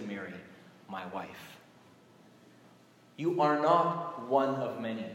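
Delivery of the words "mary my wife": hushed, as if speaking privately to his wife; soft, low and fond; tender intimacy. Shown as a demonstration.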